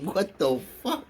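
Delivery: drawn-out laughing astonishment; amused disbelief. Retold or imitated. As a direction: act out bafflement through a laugh. Acts out repeated disbelief, laughing.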